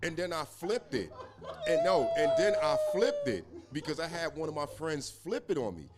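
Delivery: laughing loudly through the words; can't keep a straight face telling it. Real, not acted.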